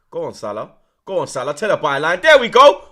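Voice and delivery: cheering rising to a big yell; excited cheering. Real, not acted. Fan watching the match cheers on Salah's run, building to a yell.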